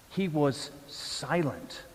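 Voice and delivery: ordinary talk, nothing marked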